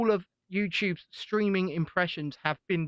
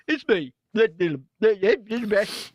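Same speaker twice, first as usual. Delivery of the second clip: Ed Miliband impression; 2 semitones higher, 4 dB louder than usual. Shown as a demonstration. [name] demonstrating the blocked-nose trick as Ed Miliband. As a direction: talk as if your nose is pinched shut. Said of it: "block off your nasal passage".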